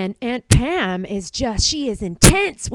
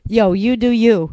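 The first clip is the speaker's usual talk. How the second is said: cool slang; 5 dB louder than usual. Pretend. Playful slang banter.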